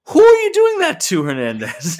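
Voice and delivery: half-joking yell at a wrestler on screen; mock call-out. Real, not acted. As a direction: yell it at the guy on TV. Calling out at TV screen.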